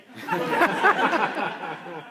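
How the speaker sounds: a big burst of real laughter; loud laugh. Real, not acted. Laughing at own punchline.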